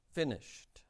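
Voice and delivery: hushed repeat of "Finished."; soft echo. Shown as a demonstration. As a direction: let "Finished." drop to a quiet echo.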